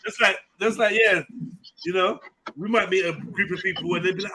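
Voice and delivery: ordinary talk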